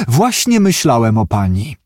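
ordinary delivery, nothing marked

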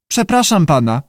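lightly squawked creature voice; squawky voice. A narrator's reading. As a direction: politely squawk from the pool. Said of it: "powiedział skrzykliwy głos".

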